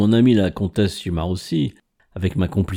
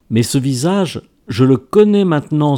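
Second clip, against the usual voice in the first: rising to an excited exclamation, louder at the end; exclaiming; 5 semitones higher, 5 dB louder than usual. Acted. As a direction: burst out with sudden certainty. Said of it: "s'exclama-t-il".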